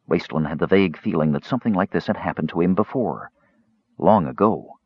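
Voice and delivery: ordinary delivery, nothing marked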